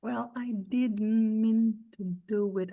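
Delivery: very slow, words kept apart; slow unlinked demo. Shown as a demonstration. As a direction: say each word separately and slowly. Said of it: "Aqui não tem nada conectado".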